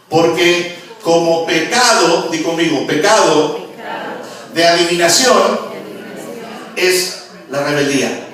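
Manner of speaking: loud, slow emphatic preaching; roaring pulpit proclamation; preaching at full force. Acted. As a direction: hammer each word out slowly. Preaching, call and response.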